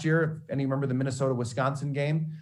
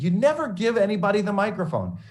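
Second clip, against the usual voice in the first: high, loud scolding mentor; mock scolding; 10 semitones higher, 4 dB louder than usual. Mentor scolding student over microphone, loud and high. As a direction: lay down the rule, pitched high and loud.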